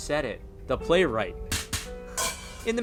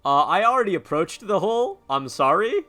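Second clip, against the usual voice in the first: higher, louder sheepish confession; 6 semitones higher, 8 dB louder than usual. Real, not acted. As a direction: admit it with a guilty laugh. Mock apology to game.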